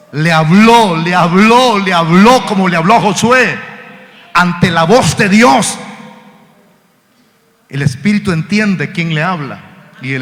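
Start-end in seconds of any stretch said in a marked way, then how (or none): 0.1-5.8 loud, insistent proclamation; forceful preaching. Real, not acted. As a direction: build by emphatic repetition.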